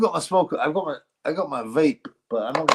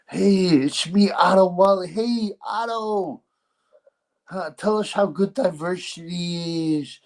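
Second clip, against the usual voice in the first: slow, higher mock Dutch accent; Dutch accent impression; 5 semitones higher than usual. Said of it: "the accent I use for Otto Wallin is actually ... Dutch".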